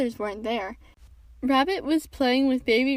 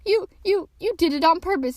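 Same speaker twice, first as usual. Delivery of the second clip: stammered, high indignant splutter; 5 semitones higher, 4 dB louder than usual. A narrator's reading. Piglet with a mouthful of soap, spluttering. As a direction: stumble into a high accusation. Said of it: "spluttered Piglet".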